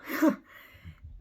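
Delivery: quick unvoiced laugh, amused at the thought; a breathy burst of laughter; short laugh. Real, not acted. Narrator laughs picturing the chase, a quick breathy laugh. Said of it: "this has actually made me laugh".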